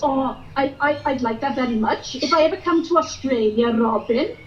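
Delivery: put-on sing-song Welsh valleys accent; chirpy Welsh valleys girl. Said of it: "I'm Welsh, love".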